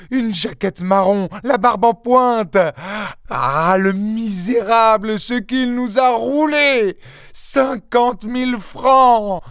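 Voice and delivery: très aigu, lent, plaintif; vocifération. Acted. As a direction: invective le voleur. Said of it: "continuait à vociférer". (épouse qui vocifère contre le faux inspecteur)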